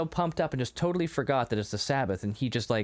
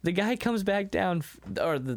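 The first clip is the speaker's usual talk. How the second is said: ordinary delivery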